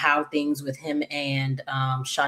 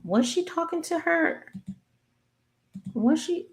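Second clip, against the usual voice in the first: high-pitched wondering aloud; puzzled self-questioning; 12 semitones higher, 4 dB quieter than usual. Real, not acted. Recapper doubting her own memory of the show, puzzled. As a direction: ask yourself in a high, unsure voice.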